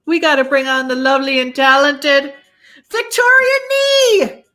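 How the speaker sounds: hyped guest announcement; welcoming the guest onto the live show, excited and loud. Real, not acted. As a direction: call her name out big and high like a host.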